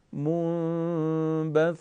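منبث with the doubled ث stretched very slowly, no break; ث held long. Shown as a demonstration. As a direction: draw out the ث of منبث smoothly. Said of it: "یه مقدار من در هنگام تلفظ این حرف رو میکشم".

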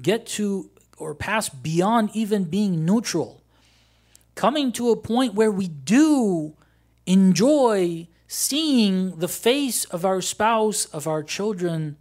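loud, slow, drawn-out exhortation; impassioned preaching. Acted. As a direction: stretch the key words with preacher's force. Preaches at full force.

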